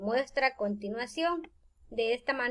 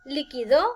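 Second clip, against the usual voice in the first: liquidó as li-qui-DÓ, louder on the end; stress on last syllable; 4 dB louder than usual. Shown as a demonstration. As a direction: punch the final DÓ of liquidó. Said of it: "la tilde en la última sílaba".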